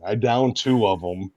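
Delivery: usual speaking voice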